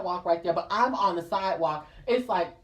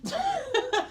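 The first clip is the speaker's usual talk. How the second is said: high giggle. Real, not acted. Laugh in a far high voice.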